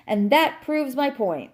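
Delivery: high-pitched triumphant declaration. A narrator's reading. Cat clinching his argument to the dog, triumphant. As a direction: drive the point home in triumph. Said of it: "he cried triumphantly".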